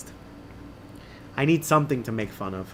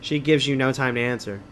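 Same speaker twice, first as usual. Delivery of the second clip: ordinary delivery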